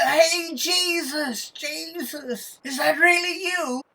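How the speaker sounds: very high, loud hail across the water; acted calling out. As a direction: call out in eager disbelief. Calls across water to Jesus.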